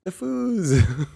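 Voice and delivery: gleeful imitation of a bouncy friend; excited bouncy quote. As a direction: mimic her bubbly delight, laughing on the last words. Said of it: "she's like".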